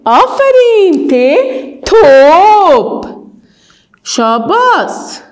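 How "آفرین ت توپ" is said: توپ stretched very slowly and louder; praise, then hold توپ out long and loud; slow drawn-out praise and word. Shown as a demonstration. Teacher praising then slowly sounding out توپ. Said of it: "بگوین".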